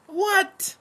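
shocked high-pitched what; incredulous what. Real, not acted. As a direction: blurt it out in disbelief. Outraged reaction to prayer.

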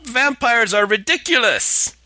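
slow, high scoffing; mocking scorn. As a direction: sneer at the idea. Mocking the ghost hunter.

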